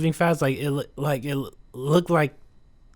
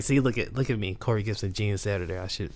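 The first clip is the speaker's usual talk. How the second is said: quiet mock boast; 7 semitones lower, 9 dB quieter, faster than usual. Mock self-praise pleading.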